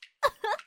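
short mocking laugh; a playful laugh; teasing laugh. Laugh between lines.

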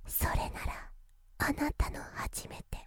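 breathy whisper at the ear. Acted. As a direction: breathe the words into their ear. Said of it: "うたなさんの吐息が耳にかかって".

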